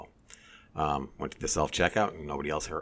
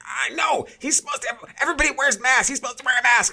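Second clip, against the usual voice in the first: high, loud, exasperated mock yell; mock indignant shout; 9 dB louder than usual. Older shopper protesting to the stock boy, mock indignant shouting. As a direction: imitate a man protesting at full volume.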